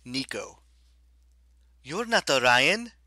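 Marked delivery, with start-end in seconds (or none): 1.8-2.9 high, loud startled outburst; blurted out. A narrator's reading. Nico blurting at the stranger, loud and high. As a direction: blurt it before thinking. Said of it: "Nico blurted".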